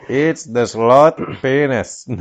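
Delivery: put-on slow, drawn-out Indian accent. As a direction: stretch it out in a mock Indian voice. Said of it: "You said the sloth penis. What is that?".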